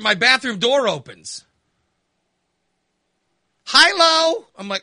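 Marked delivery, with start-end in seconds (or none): 3.7-4.5 high, loud sing-song hello; chirpy greeting. Retold or imitated. Mother bursting from the bathroom, loud high chirpy hi. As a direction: chirp it like a cheery mom.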